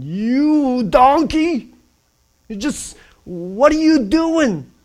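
high, slow furious shout at the donkey; angry yell. Retold or imitated. Balaam yelling at donkey.

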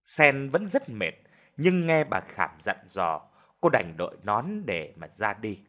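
ordinary delivery

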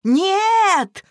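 high, loud cry of refusal; acted childish yell; children's shout. A narrator's reading. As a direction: shout it like excited kids in chorus. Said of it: "хором выкрикнули все трое".

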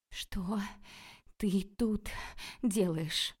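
breathless question in broken pieces; choking, out of breath. A narrator's reading. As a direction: gasp it word by word, lightly choked. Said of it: "задыхаясь".

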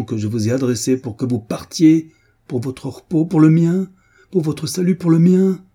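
insistent plea climbing in pitch and volume; building to a high, loud outburst; impassioned pleading. A narrator's reading. Acted confession, pleading reproach.

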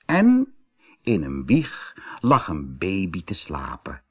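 ordinary delivery, nothing marked

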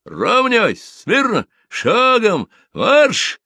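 high barked parade commands; shouting drill sergeant. Acted. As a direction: bark the drill orders across the yard. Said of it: "Теленков скомандовал".

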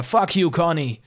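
furious parting shot, narrated; angry outburst. A narrator's reading. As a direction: spit out the curse at the caller. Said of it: "redete laut und erregt auf den Anrufer ein".

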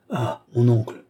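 a nephew caught off guard by his uncle; surprised nephew. A narrator's reading. Nephew greeting his uncle with a surprised cry.

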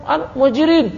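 high mock call to his own tribe. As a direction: shout "woi" up high to rally them. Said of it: "satu manggil".

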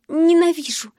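plain reading voice

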